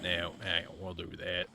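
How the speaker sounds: lightly voiced southern cowboy; a hint of a southern cowboy accent. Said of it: "talking like this".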